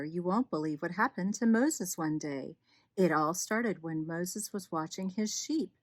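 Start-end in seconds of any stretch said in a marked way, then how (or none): none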